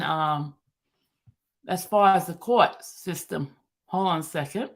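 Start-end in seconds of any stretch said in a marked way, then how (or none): 3.9-4.7 talking through a mouthful of food; words round a bite of food; mouth full. Real, not acted. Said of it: "I got food in my mouth".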